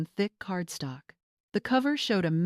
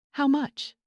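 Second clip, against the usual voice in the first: high, louder demand; 8 semitones higher, 4 dB louder than usual. A narrator's reading. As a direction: insist on an answer now. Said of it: "Greg demanded".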